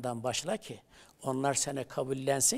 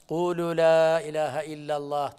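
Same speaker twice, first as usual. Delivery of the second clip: Arabic recitation; 5 dB louder, slower than usual. Acted. Prophet's call recited in Arabic.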